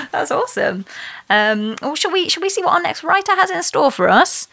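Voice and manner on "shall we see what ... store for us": normal talking voice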